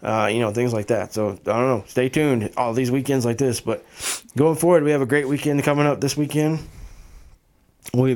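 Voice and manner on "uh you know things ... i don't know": ordinary talk, nothing marked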